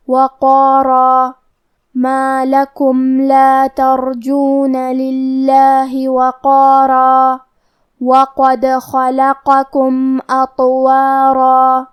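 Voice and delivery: وقارا recited very slowly, heavy qaf and long alif; heavy qaf recitation. Shown as a demonstration. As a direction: chant وقارا drawn out, full-mouthed. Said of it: "بھاری آواز میں پڑھنا". (وقارا بھاری قاف اور لمبے الف کے ساتھ، آہستہ تلاوت)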